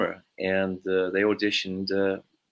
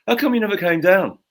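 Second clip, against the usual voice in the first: mock shout; 12 semitones higher, 7 dB louder than usual. Ozzy confronting him in Brighton.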